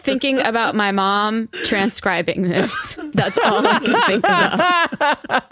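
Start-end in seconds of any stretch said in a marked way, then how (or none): 3.1-5.4 high-pitched words breaking into laughter; laughing. Real, not acted. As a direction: really crack up while talking. Player laughing at the joke.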